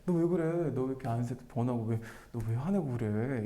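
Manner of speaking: low, soft, fatherly reproof; gentle chiding. Acted. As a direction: reason quietly, like a dad with a child. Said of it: "이렇게 하는 것처럼 하나님이". (아빠처럼 나직이 타이르는 말투)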